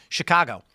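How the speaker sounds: "Chicago" with an open "ah" in the middle; correct ah vowel. Shown as a demonstration. Local showing how it is said, "Chicago" with an ah. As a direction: say "Chicago" as shi-KAH-go. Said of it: "might as well be spelled O H".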